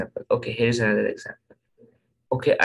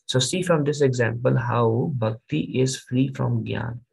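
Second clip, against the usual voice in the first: ordinary delivery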